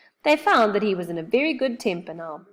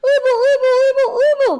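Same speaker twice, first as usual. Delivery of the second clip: much higher, loud alarmed oobling; frightened cry; 10 dB louder than usual. Acted. Moonface grabs Joe and cries out in fright. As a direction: cry out in alarm through stuck teeth. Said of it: "cried Moonface in a fright".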